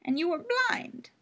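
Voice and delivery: put-on high old crone voice; old woman. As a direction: speak like a frail old gardener.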